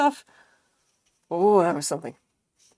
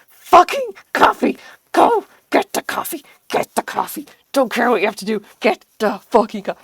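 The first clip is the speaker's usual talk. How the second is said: loud, forceful drill-it-in orders; demanding brain; 5 semitones higher, 11 dB louder than usual. Acted. Brain orders the sick body to get coffee, shouted commands. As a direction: shout it like a bossy inner voice. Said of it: "the brain was just like".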